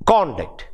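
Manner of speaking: CONduct, stressed first and falling; first-syllable stress. Shown as a demonstration. Teacher showing students the difference, first-syllable stress on conduct. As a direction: hit CON, drop off on the rest of conduct. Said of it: "You see the difference?".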